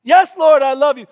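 high, loud earnest answer; fervent reply. Retold or imitated. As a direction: cry out your love.

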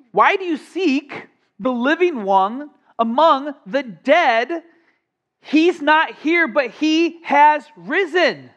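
high, slow, emphatic proclamation; angel proclaiming. Acted. Angel to the frightened women, slow and ringing. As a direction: announce it like a triumphant angel.

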